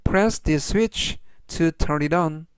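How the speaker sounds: slow, measured English for learners; teacher's deliberate, word-by-word pace; slow model reading. Shown as a demonstration. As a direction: read it out slowly for repeat-after-me. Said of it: "천천히 세 번씩 읽어드리고요".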